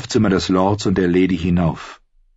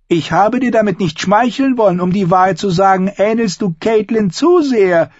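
much higher, louder noblewoman's voice; shrill imperious aunt; 11 semitones higher, 4 dB louder than usual. Acted. Lady Lysa lectures her niece, high and imperious.

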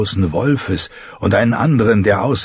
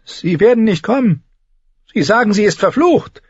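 "werden" leaned on, much higher fearful maid; fearful servant; 13 semitones higher, 3 dB louder than usual. Acted. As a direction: refuse him, scared, stressing "werden". Servant refuses the knight. (ängstliche Dienerin, betont „werden“)

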